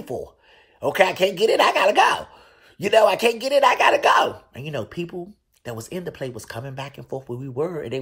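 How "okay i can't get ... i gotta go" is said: higher and louder, exasperated; loud fed-up exit. Retold or imitated. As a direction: announce loudly that you're leaving. Frustrated, deciding to leave.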